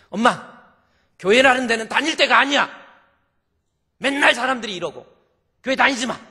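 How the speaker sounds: high, loud angry outburst; scolding her mother to quit church; angry daughter. Acted. Daughter dragging mother from church, angry and loud.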